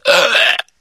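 short, throaty heave; retching gag. A narrator's reading. Sick boy interrupted mid-speech, sudden retch. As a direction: gag as if about to throw up.

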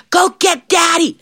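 high, drawn-out call; alarmed little devil. Acted. Little devil sends for Daddy, alarmed. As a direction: call for help high and slow.

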